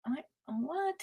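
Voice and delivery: whispered confused aside. Real, not acted. Lost in the chat scroll, whispering. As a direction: mutter it under your breath.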